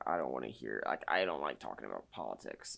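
low-pitched mock complainer voice; mocking objector. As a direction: drop your voice low to imitate people refusing to listen. Mocks people who avoid politics.

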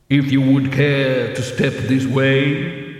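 slow, resounding otherworldly usher; voice of Death. A narrator's reading. Death invites the dead teacher to follow, solemn. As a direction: intone it loud and measured, echoing.

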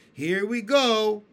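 slow, loud, high holler; excited shout. A narrator's reading. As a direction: shout as it lurches. Excited creatures as peach lurches.

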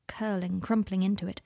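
ordinary delivery

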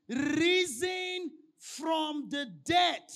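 preacher shouting Risen from the dead to the congregation; triumphant proclamation. Acted. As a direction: proclaim it with full force. Climax of the scripture reading.

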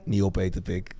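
imitating a friend's low murmur; hushed low warning to a friend; low mumbled warning. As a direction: drop the voice low and mutter it. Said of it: "Hij zegt tegen mij".

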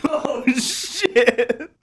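mock dismay at oneself. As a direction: catch yourself mid-blunder. Realizing his blunder.

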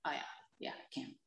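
whispered, resigned; quiet whisper admitting defeat; whispered realization. Retold or imitated. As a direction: breathe it out, giving up. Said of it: "So I knew already".

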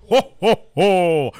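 loud, high Santa laugh; jolly ho ho ho. Pretend. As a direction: belly laugh like Santa Claus. Christmas special Santa laugh.

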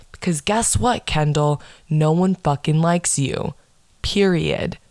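ordinary delivery

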